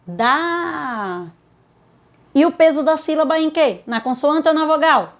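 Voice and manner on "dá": "Dá" held long on the vowel; tonic syllable isolated. Shown as a demonstration. Teacher isolates the strong syllable, "Dá" drawn out long. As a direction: sustain "Dá" as one stretched syllable. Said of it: "Sílaba tônica".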